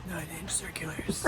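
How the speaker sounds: creepy low whisper; imitated whisper. Imitating Ray on a recording, creepy whisper. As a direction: whisper it low, right into the headphones. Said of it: "Or as Ray says".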